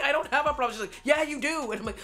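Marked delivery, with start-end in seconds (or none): 0.0-0.7 high-pitched retold protest; defensive protest. Answering someone who exploded at her, high defensive protest. Acted quarrel, defending herself. 1.0-1.7 high-pitched mimicked comeback; insistent retort. Coworker contradicting her in a quarrel, high insistent retort.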